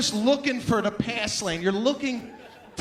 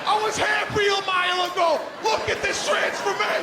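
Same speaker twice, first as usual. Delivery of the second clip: high, loud ranting shout; mock road rage; 11 semitones higher, 5 dB louder than usual. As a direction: yell your transformation at the driver. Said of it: "you're like".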